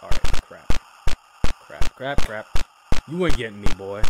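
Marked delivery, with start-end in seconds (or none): none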